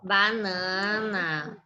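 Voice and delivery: slow, stretched-out repeat; slow approving echo. Real, not acted. Teacher repeating a pupil's answer, slowly. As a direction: draw the word out to confirm it.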